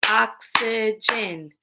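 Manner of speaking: oxygen slowly, in three separate beats; oxygen split into syllables. Shown as a demonstration. Teacher breaking words into parts with pupils, oxygen split in three. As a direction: space out each syllable of oxygen. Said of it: "Break words into parts".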